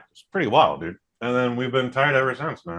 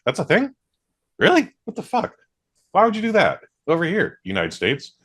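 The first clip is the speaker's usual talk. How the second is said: mock baffled; 9 semitones higher than usual. Mock baffled reaction to US custom.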